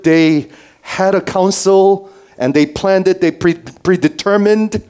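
high, sustained proclaiming tone; emphatic preaching. Real, not acted. Preacher telling congregation of the eternal council, fervent proclamation. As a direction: preach it out, holding the pitch high.

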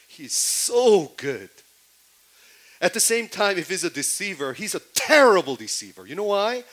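loud, high preaching climax; fervent preaching. Acted. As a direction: drive the point home to the congregation. Preaching at full force.

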